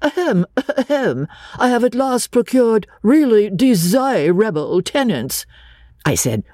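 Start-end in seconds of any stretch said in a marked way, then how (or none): 0.0-5.5 higher pompous landlord voice, hammed-up; a young man mocking a stuffy gentleman, badly; a poor mimic of a pompous man clearing his throat; indifferent mimic. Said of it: "Freddie was an indifferent mimic".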